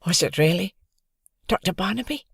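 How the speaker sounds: fearing the answer; child character, worried. A narrator's reading. Child asks.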